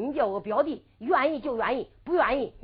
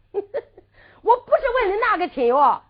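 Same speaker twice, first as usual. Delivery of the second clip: 笑着说; 6 semitones higher, 5 dB louder, slower than usual. Acted. 丫鬟笑着反问.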